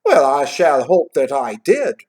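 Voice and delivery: put-on slow, loud accented boy; emphatic boy. A narrator's reading. Lad replying to Scrooge, emphatically. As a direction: punch out the reply slowly and loudly.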